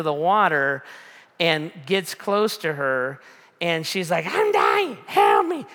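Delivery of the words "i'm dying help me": high, frantic mock screaming; hammed-up panic, each plea cried out; freaking out. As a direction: imitate a drowning girl shrieking for rescue. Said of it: "kinda just freaking out".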